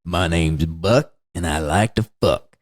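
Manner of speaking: low, slow sleazy drawl; creepy Buck. Retold or imitated. Quoting Buck from Eaten Alive in a low sleazy drawl. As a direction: drop low and leer through it. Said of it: "and he goes".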